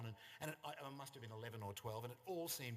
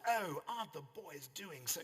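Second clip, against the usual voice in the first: a lightly voiced posh society mother, a bit higher; hint of a gushing Sydney mum; posh North Shore mum; 5 semitones higher, 3 dB louder than usual. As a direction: start the gushing mum's line, then break off. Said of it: "'cause women spoke like that in those days".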